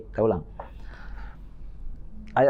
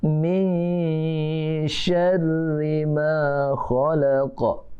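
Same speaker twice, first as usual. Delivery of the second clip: slow drawn-out Quran chant, higher; Quran recitation; 4 semitones higher, much slower than usual. Shown as a demonstration. Teacher chanting a verse for children to repeat, slow and drawn out. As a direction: chant each word slowly for the class to copy.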